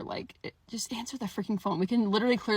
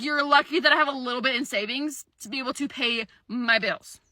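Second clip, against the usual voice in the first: normal talking voice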